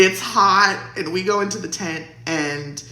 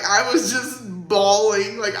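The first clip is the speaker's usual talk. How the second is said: usual speaking voice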